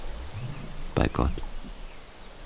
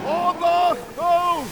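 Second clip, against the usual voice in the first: startled cry; slower than usual. Acted. Sudden warning cry.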